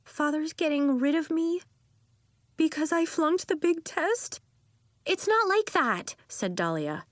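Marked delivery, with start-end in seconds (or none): 0.0-4.4 put-on high, upset little-girl questions; small high voice, mildly tearful; hurt child. A narrator's reading. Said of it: "Nori's lip trembled". 5.1-6.1 high reassuring sister. A narrator's reading. Sister reassures upset child.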